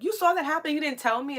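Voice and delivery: high-pitched imitation of a complainer; hammed-up high complaint; mock accusation. Imitates someone accusing the speaker.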